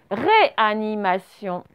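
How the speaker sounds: slow, separated syllables. Shown as a demonstration. Teacher dictates last word to pupils, syllable by syllable. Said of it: "syllabe par syllabe".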